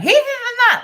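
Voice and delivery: very high, loud crazed shriek; like a crazy person. Retold or imitated. Retelling the shriek that got noticed. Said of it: "like a crazy person".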